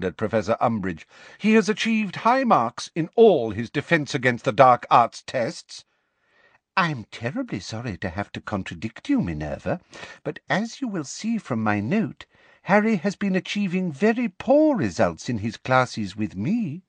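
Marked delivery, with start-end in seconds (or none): 1.4-5.8 higher-pitched, emphatic statement; brisk, indignant schoolmistress; firm defence. Acted. McGonagall defends Potter, first statement. 6.7-16.8 simpering professor. Acted. Umbridge contradicting politely.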